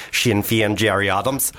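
Northern Irish warm-up phrase; Irish accent. Shown as a demonstration. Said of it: "to get into an Irish accent".

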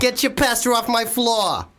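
put-on high, drawn-out Italian-American New York accent; indignant put-on Italian-American accent, slow and high; aggressive Italian-American. Podcaster plays aggressive New Yorker over spilt pasta, Italian-American accent. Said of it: "He's an Italian-American gentleman, and he's aggressive".

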